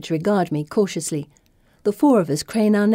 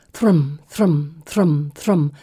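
heavy, even beats like a drum; pounding heartbeat; much slower than usual. A narrator's reading. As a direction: thud each word like a heartbeat.

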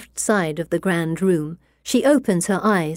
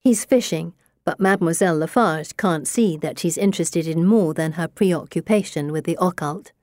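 plain reading voice

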